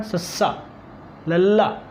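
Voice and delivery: ਸੱਸਾ, ਲੱਲਾ sounded out with a pause between; teacher carefully pronouncing ਸੱਸਾ, then ਲੱਲਾ; pronunciation demo. Shown as a demonstration. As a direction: say ਸੱਸਾ and ਲੱਲਾ as separate letter names. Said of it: "ਇਹਦਾ ਉਚਾਰਨ ਕਰਾਂਗੇ ਤਾਂ".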